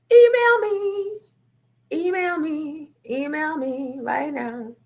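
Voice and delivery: high, slow drawn-out call; slow sung-out email me; sing-song plea. Acted. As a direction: stretch it out up high. Urgent call to action.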